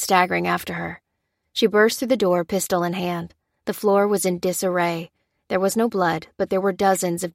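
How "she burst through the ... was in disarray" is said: plain reading voice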